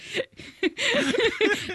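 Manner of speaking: real high-pitched laughter; high giggle. Real, not acted. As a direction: burst into giggles. Laughing at the memory.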